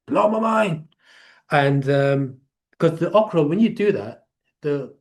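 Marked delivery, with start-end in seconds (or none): 0.1-0.9 exclaimed. Real, not acted. Telling friends about okra water, an amazed outburst. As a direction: Blow punched high in real amazement.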